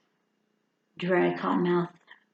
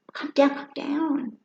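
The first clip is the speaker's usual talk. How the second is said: slow, higher mock calm-down; mock soothing; 7 semitones higher, slower than usual. Teasing someone freaking out, slow mock calm down. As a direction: drawl it out to tease. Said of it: "That's my new joke".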